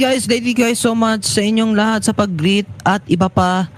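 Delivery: usual speaking voice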